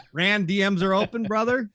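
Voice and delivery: loud, high invitation to a friend; playful call-out. Real, not acted. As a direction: call out with a teasing nudge. Calls out to gifted friend.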